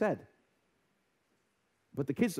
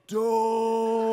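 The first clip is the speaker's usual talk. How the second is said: slow-motion don't; 4 semitones higher, 6 dB louder than usual. Retold or imitated. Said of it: "my wife's in slow motion".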